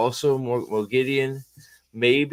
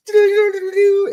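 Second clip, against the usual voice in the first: very high, loud trilled guitar lick; mimicked guitar riff; 7 dB louder than usual. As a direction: imitate a squealing guitar solo with the voice. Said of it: "pulls out his guitar and goes".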